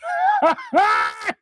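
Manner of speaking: high-pitched burst of laughter. Real, not acted. Streamer reacting to the chat, high laughing. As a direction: crack up in a high cackle.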